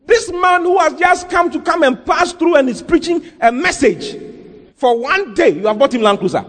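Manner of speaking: high-pitched, indignant tirade; retold angry rebuke. Slighted pastor rebuking his church member, retold anger. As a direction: scold them as the slighted pastor, voice pushed high. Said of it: "He got angry".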